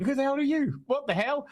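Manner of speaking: high-pitched mock bewilderment; baffled captives. Captured heroes answer the villain who chased them, baffled. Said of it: "And they're like".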